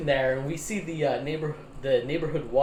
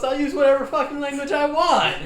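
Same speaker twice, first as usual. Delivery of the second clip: defiant retort; 11 semitones higher, 6 dB louder than usual. Retold or imitated. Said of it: "And Cade goes".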